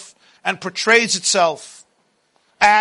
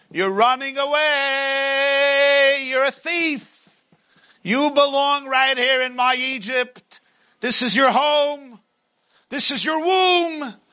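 higher, slow, taunting Pharaoh voice; Pharaoh's voice; 4 semitones higher than usual. Acted. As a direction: loom over them as a tempting tyrant. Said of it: "Paro is there ... and saying".

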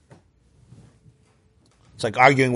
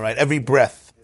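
usual speaking voice

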